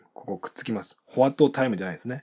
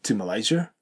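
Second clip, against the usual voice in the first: "to Malaysia" rising up at the end. Shown as a demonstration. Teacher shows question's rising end. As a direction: lift the tune on "Malaysia". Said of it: "尻上がり".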